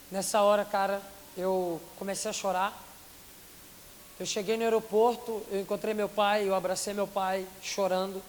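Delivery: usual speaking voice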